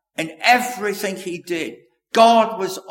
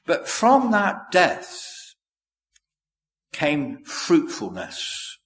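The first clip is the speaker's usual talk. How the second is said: slow solemn emphasis; 2 semitones higher, much slower than usual. Real, not acted. Sermon climax, drawn out.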